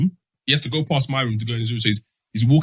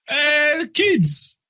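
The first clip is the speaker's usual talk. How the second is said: breezy dad; 11 semitones higher, much slower than usual. Retold or imitated. Dad acting casual after beating.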